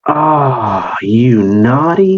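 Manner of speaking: slow, drawn-out doting tease; fond scolding. Acted. Owner fondly scolding his wandering cat, drawn out. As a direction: tease the cat lovingly and slowly.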